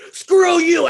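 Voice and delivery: loud, high shout back; the stranger yells back at the boys, loud shout; yelled back. Retold or imitated. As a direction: fire it back at them. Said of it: "he yells back".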